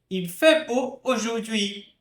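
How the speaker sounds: "Il fait beau aujourd'hui" high and careful; model French. Shown as a demonstration. Teacher repeating the sentence at the end. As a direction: say the sentence clearly in one go. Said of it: "teacher models sentence".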